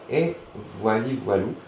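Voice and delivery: normal talking voice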